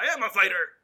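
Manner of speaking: retold defiant shout. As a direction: shout it back, mock-indignant. Retold angry comeback from Gorgon.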